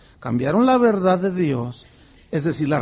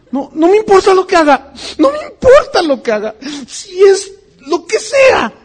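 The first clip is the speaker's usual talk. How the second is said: high, loud, crying mock adoration; hammed-up weepy believer gushing over his idol; mock fanatic devotee; 11 semitones higher, 9 dB louder than usual. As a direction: ham up a tearful gushing fan. Mocks idolizing believer's fervor.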